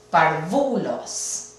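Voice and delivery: parvulos slow, stress on the VU syllable; wrong stress on VU. Shown as a demonstration. Teacher testing the penultimate stress, stress on VU. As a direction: say parvulos as parVUlos, slowly. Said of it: "acento en la penúltima".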